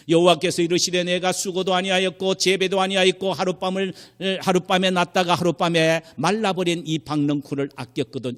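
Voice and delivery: high, even recitation without pauses; scripture reading. Retold or imitated. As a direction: recite the verse straight through as written. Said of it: "여기 지금 성경에 보면요". (높고 고른 어조의 성경 낭독)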